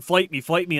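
ordinary talk, nothing marked

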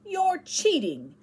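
slow, high, cross accusation. A narrator's reading. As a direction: drag it out, a boy who feels cheated. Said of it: "said David crossly".

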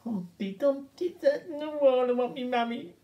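high, sing-song nursery voice; sing-song nursery rhyme. Retold or imitated. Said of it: "normally you might hear Humpty Dumpty like".

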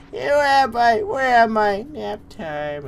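put-on high, loud lost old-timer; bewildered elderly customer; confused old person. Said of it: "Falling asleep in, on the aisles".

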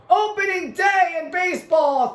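high-pitched, thrilled proclamation; excited announcement. Real, not acted. Gamer telling viewers it's opening day, excited. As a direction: announce it like the big news of the day.

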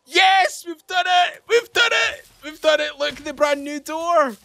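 very high-pitched excited shouting; triumphant cheering. Real, not acted. Player celebrating the brand new door, elated high shouting. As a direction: whoop with victory, voice soaring up.

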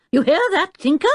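high, loud rising challenge; pointed fatherly question; sharp rebuke. A narrator's reading. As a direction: round suddenly on the child. Professor turns suddenly on son.

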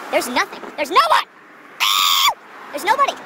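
rising, high, loud comic yell; comic shouts of alarm; mock panicked shouting. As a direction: holler it like you're stranded, hammed up. Mock panic at empty lot.

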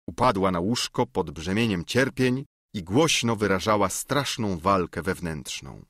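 ordinary delivery, nothing marked

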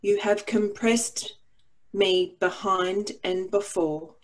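usual speaking voice

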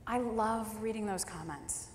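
ordinary talk, nothing marked

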